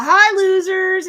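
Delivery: high, loud, drawn-out taunting greeting; Titania. Retold or imitated. Villainess bursting through the wall, taunting. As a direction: sing out a gleeful mock hello. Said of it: "Titania coming in".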